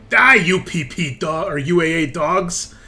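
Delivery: put-on loud, slow thick Russian accent shout. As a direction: bark it like a Russian officer. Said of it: "a thick Russian accent".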